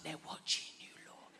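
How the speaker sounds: conspiratorial whisper; paranoid whisper. Retold or imitated. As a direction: hush it like they're listening.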